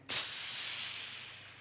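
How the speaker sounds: long voiceless searing hiss; sizzling hiss. Acted. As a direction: imitate a hot coal sizzling. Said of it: "touched my mouth and went".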